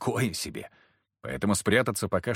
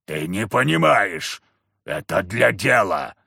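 slow, lower man's voice, defensive; gruff excuse; 3 semitones lower, 4 dB louder, slower than usual. A narrator's reading. Husband defends his affair to the wife, slow and gruff. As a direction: grumble it in defence.

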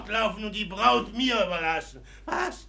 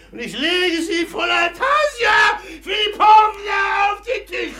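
put-on higher, louder drunken promise; loud drunk vow; 9 semitones higher, 5 dB louder than usual. Ragoschin swears to bring the money, a drunken shout. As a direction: bellow the vow across the room.

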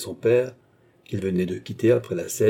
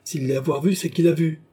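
higher-pitched, indignant retort; angry indignation; 7 semitones higher, 3 dB louder than usual. A narrator's reading. André defending Monsieur de Tavernay, angry indignation. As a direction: insist indignantly, rising in pitch. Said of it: "pâle de colère et d'indignation".

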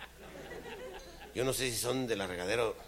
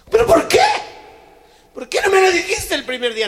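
shrill, loud, pitched way up; shrieking demand; 16 dB louder than usual. Acted. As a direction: scream the question at him. Mujer reclama a gritos. (grito agudo de reclamo)

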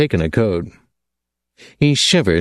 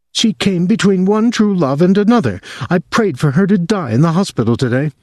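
put-on higher, intense voice of a fixated young woman; obsessive young woman; 9 semitones higher than usual. A narrator's reading. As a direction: she clings to him, urgent and possessive. Virginia's jealous ranting.

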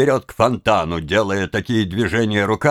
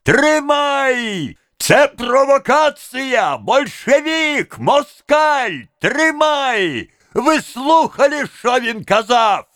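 high, stretched accusing yells; cracked, tearful shouting of accusations; spiteful, whining cry; 12 semitones higher, slower than usual. A narrator's reading. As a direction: rouse the crowd with high, malicious cries. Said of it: "закричал мужской, надтреснутый, злобный, плаксивый голос".

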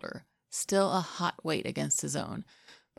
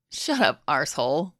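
laughing; 2 semitones lower, 5 dB louder than usual. A narrator's reading. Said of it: "Harry laughed".

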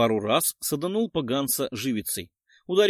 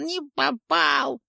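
high, slow, gloating creature squeal; gleeful ghoul yell; 11 semitones higher, much slower than usual. A narrator's reading. Ghoul gloats at the witcher, high squeaky glee. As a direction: crow over the miss like a nasty little ghoul. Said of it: "радостно завопил поганец".

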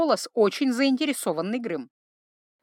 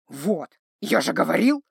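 delighted troll rumble; gravelly delighted troll; grinding stone gravel voice; 4 semitones lower than usual. A narrator's reading. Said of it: "восхитился тролль".

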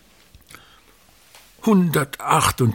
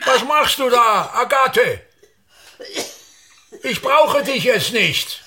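high, sharp-tempered brush-off of Agathe; irritable dismissal; 11 semitones higher than usual. Acted. Old actor shooing Agathe out, sharp and peevish. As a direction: send her away, testy and above it all.

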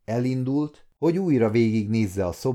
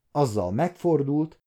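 usual speaking voice

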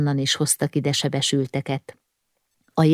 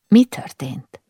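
higher, whispered question; hushed question; 6 semitones higher, slower than usual. A narrator's reading. Woman kneeling by the dead man asks her companion, hushed. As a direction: whisper it, starting high.

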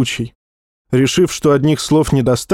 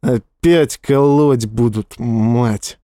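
muttered through teeth; 3 semitones lower, much slower than usual. A narrator's reading. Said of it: "Зло процедили сквозь зубы".

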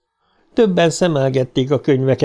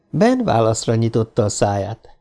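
ordinary delivery

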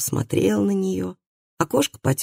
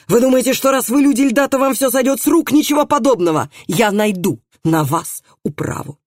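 high, heated outburst of indignation; indignant high-pitched tirade, slowing at the end; outraged threat; 8 semitones higher, 3 dB louder than usual. A narrator's reading. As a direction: rail at them, voice pitched up. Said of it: "Наконец прорычал он".

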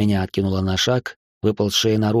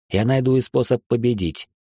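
plain reading voice

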